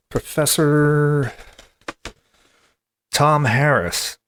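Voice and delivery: Professor drawn out slow and high; slow grand announcement. Shown as a demonstration. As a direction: announce Tom Harris slowly with pomp. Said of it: "Professor Tom Harris contacted me".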